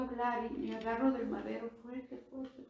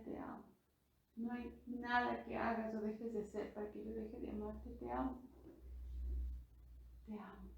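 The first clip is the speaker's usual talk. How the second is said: much softer, tender whisper-like; hushed, loving, fading to almost nothing; soft loving words; 6 dB quieter than usual. Retold or imitated. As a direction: say it like an intimate promise. Lord's silent words of love.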